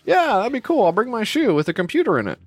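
high-pitched, eager put-on reply; mock-eager friend. Acts out eager friend agreeing.

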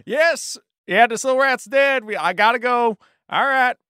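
high excitable shopkeeper voice; chirpy fast-talking merchant; high character voice. Acted. Player voices Chip.

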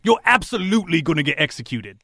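blunt cellmate, touch of mockery; lightly played jail lowlife, matter-of-fact; lightly voiced rough cellmate. Cellmate tells prisoner he'll be executed, lightly mocking.

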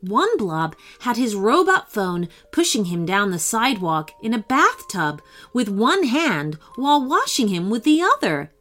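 ordinary delivery

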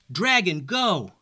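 high urgent shout; yelling. Acted. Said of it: "yelled Simon".

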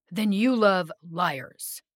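loud, drawn-out accusation; snapped back. A narrator's reading. The being accusing Opal's friends of lying, snapping. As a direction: snap back with slow, heavy force. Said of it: "he snapped back".

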